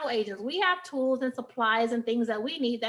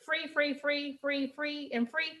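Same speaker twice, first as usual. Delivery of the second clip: "free" repeated lightly, a little softer; 3 semitones higher, 6 dB quieter, slower than usual. Shown as a demonstration. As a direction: tap "free" over and over. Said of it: "Did I say that?".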